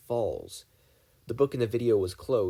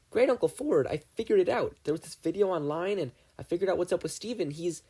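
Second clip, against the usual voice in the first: excited Dipper; 7 semitones higher than usual. Acted. Dipper rushes to tell Ford the news, higher and excited. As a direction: blurt it out all at once.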